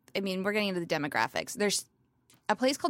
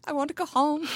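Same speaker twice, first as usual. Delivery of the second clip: mock crying; 7 semitones higher than usual. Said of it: "I start crying. I'm like".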